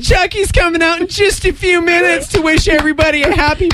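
put-on high, fast kids' party host; Chuck E. Cheese host. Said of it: "she sounds like the girl from Chuck E. Cheese".

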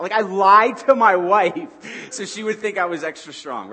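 loud, laughing through the admission; laughing confession. Real, not acted. Preacher confessing a lie to his wife, laughing. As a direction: laugh it out, sheepish.

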